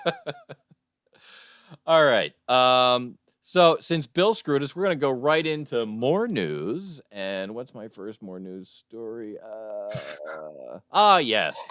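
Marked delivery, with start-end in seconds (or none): none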